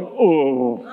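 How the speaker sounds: low, sick mock groan; acted-out nauseous moan; queasy groan. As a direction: groan as if about to throw up. About to vomit on ride.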